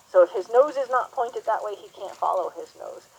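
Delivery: usual speaking voice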